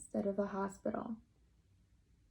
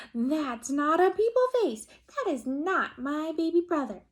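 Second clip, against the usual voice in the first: higher and louder insistence; loud protest; 7 semitones higher, 6 dB louder than usual. Acted. Kristen protesting to her mother, high and loud. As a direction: insist hard it's wrong. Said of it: "and said".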